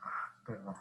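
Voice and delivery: a soft unvoiced apology under the breath; hushed little sorry to oneself; whispered aside. Real, not acted. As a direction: whisper it away from the mic. Aside after a long pause.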